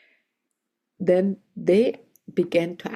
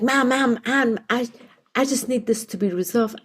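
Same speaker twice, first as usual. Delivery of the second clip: flustered; 6 semitones higher than usual. Retold or imitated. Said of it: "he was so resistant".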